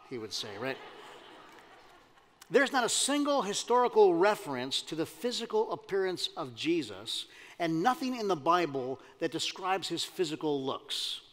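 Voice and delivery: ordinary talk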